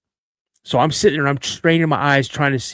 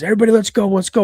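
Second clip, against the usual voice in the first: acted command, higher and a bit louder; rally the troops in a raised, high-pitched voice; urgent rallying call; 8 semitones higher, 5 dB louder, much faster than usual. Instructor sending everyone running toward gunfire, urgent rallying call.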